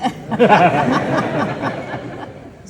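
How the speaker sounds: loud delighted laugh. Real, not acted. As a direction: burst out laughing. Laughs at son's answer.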